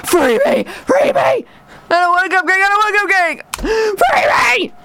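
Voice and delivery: very high, squealed pleas; mock desperation. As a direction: plead like a hammed-up captive. Mock captive begging to be freed.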